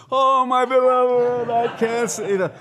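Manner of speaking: loud, very high theatrical wail; mock crying. Rama resumes his act for the onlookers, loud mock crying. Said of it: "he goes back to crying".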